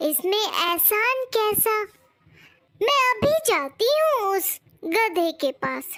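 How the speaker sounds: lightly voiced obliging bird; a hint of an eager little helper; helpful bird. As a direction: reassure the king modestly. Bird offers the king help.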